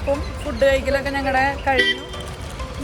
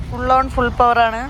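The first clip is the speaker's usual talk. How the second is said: malayalam english mix; 3 semitones lower, 7 dB louder, slower than usual. Real, not acted. Excited vlog outro hype.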